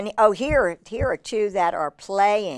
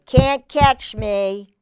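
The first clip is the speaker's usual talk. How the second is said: loud, drawn-out squirrel taunt; 2 semitones higher, 6 dB louder, much slower than usual. Acted. Squirrel taunting the hawk, drawn-out playful tease. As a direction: tease the chaser, slow and loud. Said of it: "he'll say".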